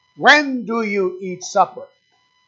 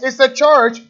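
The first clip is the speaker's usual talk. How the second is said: fervent outcry; 5 semitones higher, 6 dB louder than usual. Acted. Preacher condemns the church for locking Jesus out, loud outcry. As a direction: preach it loud and high, slowing at the end.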